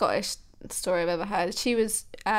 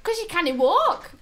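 high mimicked child's retort; little boy; 10 semitones higher, 4 dB louder than usual. Little boy answering the doctor, high child voice. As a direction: imitate a small boy's blunt answer, pitched up. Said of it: "the boy was like".

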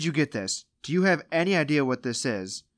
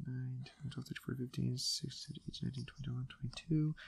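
counting under the breath, much softer; tally the score quietly to yourself; muttered counting; 15 dB quieter, faster than usual. Real, not acted. Host counts up points under his breath, muttering.

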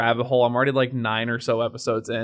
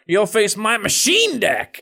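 higher, slow menacing boast; slow villain boast; 6 semitones higher, slower than usual. Retold or imitated. Friend mocking a cartoon duelist, slow and boastful. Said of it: "He's like".

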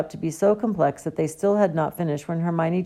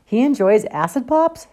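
puzzled question, higher and louder; bemused disbelief; perplexed; 8 semitones higher, 6 dB louder than usual. Acted. Ron reads Dumbledore's note over Harry's shoulder, perplexed. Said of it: "looking perplexed".

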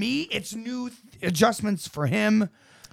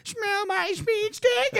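high, slow, squeaky cartoon voice; high Peter Griffin impression; 14 semitones higher, much slower than usual. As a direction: pitch it way up and drag it out. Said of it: "be like".

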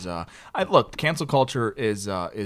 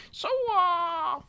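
very high, drawn-out put-on lead-in; imitated lady. Lady opening small talk at an event, high imitation.

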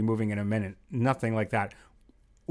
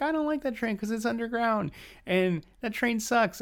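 higher, whiny mock complaint; mimicking wife; 10 semitones higher than usual. Husband imitating his wife refusing the west side train, higher mock whine. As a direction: play her griping, pitched up.

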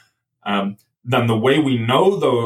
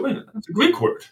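much higher, sudden "wait" of discovery; startled realisation; 4 semitones higher than usual. Retold or imitated. Guest retelling hearing a Greek word at Passover, startled. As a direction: catch yourself hearing something unexpected. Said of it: "I said".

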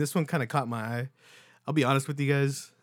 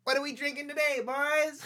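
announcer call. Acted. Podcast intro call-out.

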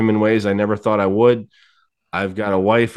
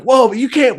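high, loud panicked protest; freaking out; 6 dB louder than usual. Retold or imitated. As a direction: mimic a buddy freaking out in alarm. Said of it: "he's freaking out".

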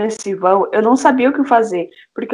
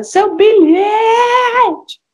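shouting after someone; 11 semitones higher, much slower than usual. Retold or imitated. Woman shouting after girl about her ticket. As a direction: call out across the street. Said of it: "a moça gritando".

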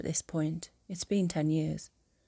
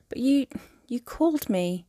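higher, halting woman's voice; hesitant protest; 6 semitones higher, 3 dB louder than usual. A narrator's reading. She reminds him of the name he called her, higher halting protest. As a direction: raise the voice and falter after "you".

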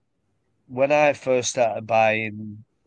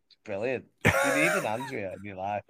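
ordinary talk

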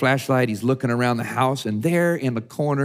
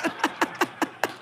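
high, breathless laugh; airy laugh; 4 dB quieter than usual. Real, not acted. Preacher cracking up after the punchline. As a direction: let the laugh out at your own joke. Laughing at his own joke.

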